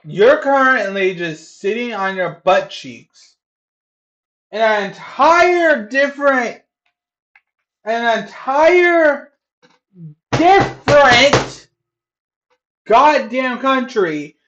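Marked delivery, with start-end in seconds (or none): 4.5-14.4 slow, loud, high, every word hammered; exasperated scolding. Real, not acted. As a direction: drag it out in outrage. Berating chatter, slow emphasis.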